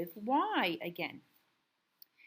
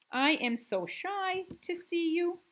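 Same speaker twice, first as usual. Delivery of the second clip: high reading voice; 10 semitones higher, 4 dB louder than usual. Shown as a demonstration. Teacher reads sentence in high voice.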